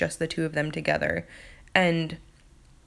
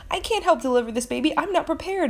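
mock panic; 9 semitones higher, faster than usual. Annie panicking about delivering baby.

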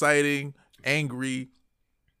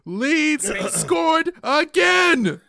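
very high, loud, long-drawn wail; exasperated outcry; 12 semitones higher, 7 dB louder, much slower than usual. Retold or imitated. Fan retelling Leeds tying it again, wailing in exasperation. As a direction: stretch every word in hammed-up disbelief. Retelling another equalizer in mock agony.

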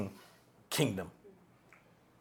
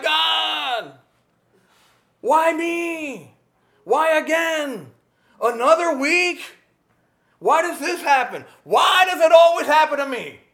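mock whining; 8 semitones higher, slower than usual. Shown as a demonstration. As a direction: whine at God like a fed-up child. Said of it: "That's whining".

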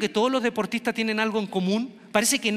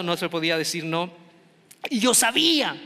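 ordinary talk, nothing marked

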